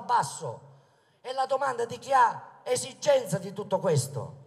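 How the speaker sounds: loud rally oratory, projected to the crowd; booming balcony speech. Acted. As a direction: proclaim it from the balcony.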